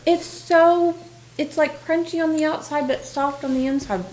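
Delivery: chewing while talking; mouth full. Real, not acted. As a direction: talk through a mouthful of scone. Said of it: "I'm talking with my mouth full".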